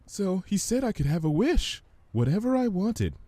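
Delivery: high, gentle timid voice. Acted. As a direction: voice her shyly and high. Fluttershy voiced by narrator.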